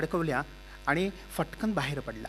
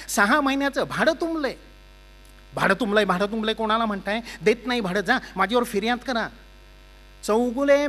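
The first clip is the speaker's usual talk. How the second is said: loud, higher threatening demand; angry landlord; 5 semitones higher, 4 dB louder than usual. Acted. Landlord demanding six months' rent, loud angry threats. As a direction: threaten him over unpaid rent.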